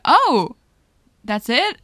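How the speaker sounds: loud, high mock surprise. Retelling a principal scene, loud mock surprise. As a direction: act stunned at the news. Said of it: "And she's like".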